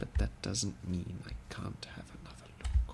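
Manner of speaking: soft unvoiced whisper, sneaking. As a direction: whisper it as if creeping past enemies. Sneaking through looted buildings.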